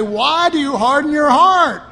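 loud, high challenging rebuke. Retold or imitated. The real Jesus challenging the sheep, loud rebuke. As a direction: demand it of the crowd. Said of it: "he would say".

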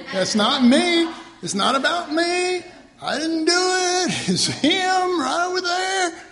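high, loud protesting cry; John the Baptist. Retold or imitated. Preacher acts the first Baptist pointing to Jesus, loud protest. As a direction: call out frantically, pointing everyone elsewhere. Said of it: "John the Baptist".

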